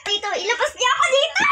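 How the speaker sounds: high shouted plea; desperate demand. Acted. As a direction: call out to be let out of jail. Prisoner calling to be released.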